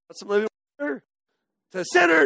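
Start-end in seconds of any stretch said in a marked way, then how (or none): 0.1-1.0 soft, syrupy offer, a hint sarcastic; mock a too-gentle invitation, lightly; overly sweet mock Jesus. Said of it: "No, he didn't say it like that".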